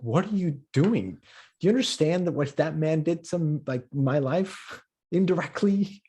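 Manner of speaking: indignant disbelief. Acted. Comic to someone in an Escobar shirt, indignant. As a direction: confront them, pitch lifted in dismay. Imagined rebuke to shirt wearer.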